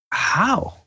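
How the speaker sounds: high shocked question; stunned how. Retold or imitated. Asking the drunk man how it happened, aghast. Asks how daughter died.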